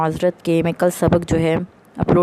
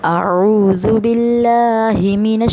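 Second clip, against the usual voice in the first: slow, drawn-out chanted recitation; Quran recitation; much slower than usual. Acted. Teacher opening the lesson for listeners, slow chanted recitation. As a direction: intone each word slowly and reverently.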